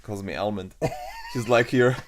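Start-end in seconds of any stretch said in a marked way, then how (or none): 0.8-2.0 loud, laughing retelling of a tease; amused and loud, laughter breaking in; real laughing. Real, not acted. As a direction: tell it through your own laughter. Laughing over partner's teasing nickname.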